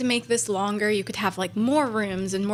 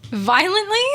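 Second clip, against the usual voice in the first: amused disbelief; 9 semitones higher, 5 dB louder, slower than usual. Real, not acted.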